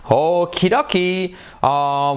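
usual speaking voice

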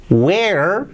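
"where" drawn out into a strong final R; well-marked R. Shown as a demonstration. Teacher shows learners the R, "where" with R well marked. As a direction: lean on the R ending "where". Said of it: "con la R bien marcada".

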